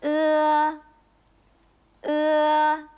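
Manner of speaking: long "うー" as an o through an e-shaped mouth; Mandarin e vowel. Shown as a demonstration. Native model repeats the new vowel, long unrounded "うー". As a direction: hold an unrounded, throaty "うー". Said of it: "えの口で、".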